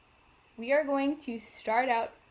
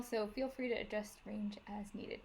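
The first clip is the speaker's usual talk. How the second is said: whisper; 3 semitones lower, 13 dB quieter than usual. Real, not acted.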